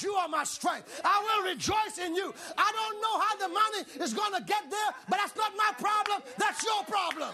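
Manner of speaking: fired-up preacher declaring faith; full-force preaching. Real, not acted. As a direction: shout praise with punched stresses.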